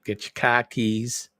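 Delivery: a light Boston accent, slowly, dropping the r's; lightly voiced Bostonian, no r's, slowly. Shown as a demonstration. Host showing his own Bostonian accent, lightly voiced and slow. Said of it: "that typical".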